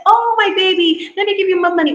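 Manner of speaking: a doting mom cooing to her child, mock tender; mock doting mother. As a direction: coo it like an indulgent mother. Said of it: "You say".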